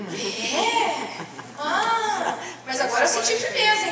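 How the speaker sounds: laughing through the words, voice lifted; relieved chuckle as hope comes back; giggling with relief at the nurse's answer. Retold or imitated. Relieved after nurse's reply.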